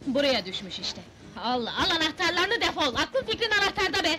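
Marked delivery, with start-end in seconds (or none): 1.4-4.2 raised, indignant scolding; fed-up outburst; angry shouting. Acted. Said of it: "Bağırma, kurban olayım!".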